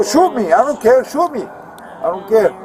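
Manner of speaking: defiant retold shouting; retold defiant yell. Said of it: "I go".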